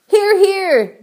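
high cheer of agreement; group shout. A narrator's reading. Creatures all agree with ladybird, shouted agreement. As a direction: cry it out high, like a crowd agreeing. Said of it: "they all shouted".

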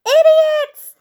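very high, very loud yell; furious yell. A narrator's reading. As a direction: bellow the insult at them. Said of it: "he yelled".

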